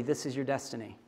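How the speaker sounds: hushed, close and tender; quiet affirmation to a little girl; soft murmur to child. Retold or imitated. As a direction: say it softly into a small child's ear. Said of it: "she says into May Mobley".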